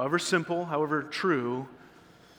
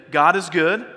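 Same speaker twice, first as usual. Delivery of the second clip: loud call; 2 semitones higher, 7 dB louder than usual. Shown as a demonstration. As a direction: call out "God is good" loudly to the congregation. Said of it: "let's try it together".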